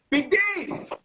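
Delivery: high, bright calling out a name; excited call. Real, not acted. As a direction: shout his name in welcome. Greeting co-host calling out.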